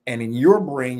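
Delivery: ordinary talk, nothing marked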